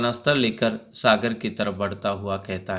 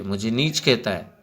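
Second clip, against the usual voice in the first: plain reading voice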